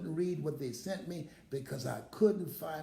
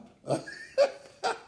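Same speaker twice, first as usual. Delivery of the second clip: breathy laugh; 6 dB louder than usual. Real, not acted. Laughs after "I feel you.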